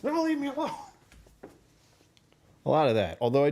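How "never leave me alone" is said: much higher squeaky plea; mock game character. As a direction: voice a clingy little game figure. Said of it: "you just have".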